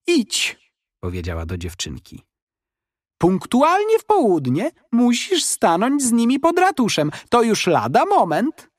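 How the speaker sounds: put-on high old woman's voice giving firm instructions; urgent old lady. A narrator's reading. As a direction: send the girl off in a hurry.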